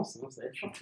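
fading, quick aside to yourself; trailing off. Real, not acted. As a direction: mutter it under your breath. Muttered aside while checking something.

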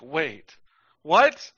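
reacting to a missing scene in mock surprise; mock disbelief. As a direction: replay your own baffled double take. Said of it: "I was like".

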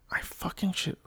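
quick whispered curse under the breath; whispered aside. Real, not acted. Whispering to herself mid-vlog, a muttered aside. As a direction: hiss it to yourself, not the audience.

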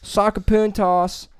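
slow, high, drawn-out Sacapuntos; mock Spanish. Showing off a Spanish word, slow and high. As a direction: roll out Sacapuntos like a proud student.

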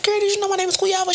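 high, fast, breathless question; bursting to tell mom the news; gushing to her mother on the phone; excited disbelief. Retold or imitated. Calls mama in disbelief.

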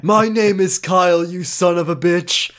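loud, high playacted defiance; hammed-up challenge; mock heroic retort. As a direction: declare it loudly as a hammy hero.

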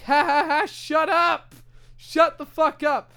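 sarcastic ha ha then tell them to shut up; imitating own reaction to noisy moviegoers, fake laugh then angry; mock fake laugh then angry. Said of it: "And I'm like".

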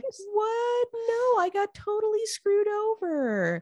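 high, drawn-out mock wail; hammed-up sad. Cheated son begging dad for a blessing, mock-sad wail. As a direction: plead in an exaggerated high whine. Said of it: "is so sad".

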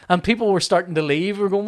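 ordinary talk

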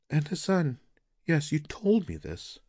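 recalling what he already told her; wife gently correcting her husband; wife's voice. Acted.

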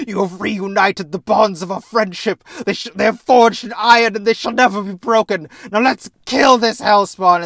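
loud, high, hammy declaration; pompous Theseus. Retold or imitated. Theseus vowing friendship to the Minotaur, loud and hammed-up. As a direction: proclaim it like a grandiose knight. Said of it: "He's like".

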